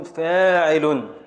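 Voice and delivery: slow scansion. Shown as a demonstration. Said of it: "النون سكون".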